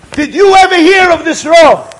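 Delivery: high, shouted question; impassioned demand. Retold or imitated. Old man demanding whether the rabbi knows him, shouting.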